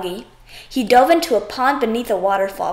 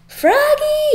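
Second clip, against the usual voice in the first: high loud yell of his name; dad yelling his son's name in alarm; 5 dB louder than usual. Acted. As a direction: yell to stop him. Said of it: "yelled his dad".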